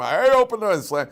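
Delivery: a hammed-up slam of a rude kid; brash teen; mock teen outburst. Teen cursing bus driver.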